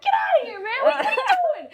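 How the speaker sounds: high-pitched mock scolding yell; yelling like at a dog. Storyteller re-enacting shooing the man like a dog, yelling. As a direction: shoo him off in a high, half-laughing yell. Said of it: "I started yelling like he's a dog".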